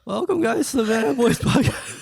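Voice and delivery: loud, slow hosting voice; podcast intro. Pretend. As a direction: announce it like a big show.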